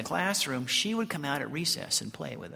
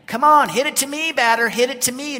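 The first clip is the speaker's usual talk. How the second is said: loud, high infield holler; imitated shout; 9 semitones higher, 11 dB louder than usual. Granny at shortstop calls to the batter, imitated shout. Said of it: "she would shout at you".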